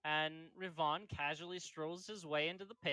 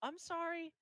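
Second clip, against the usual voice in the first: put-on apologetic girl; 14 semitones higher than usual. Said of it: "she's like".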